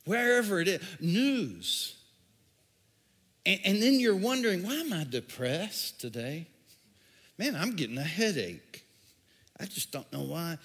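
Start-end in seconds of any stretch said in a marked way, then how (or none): 4.6-10.6 mock-weary complaining. Depressed scroller complains.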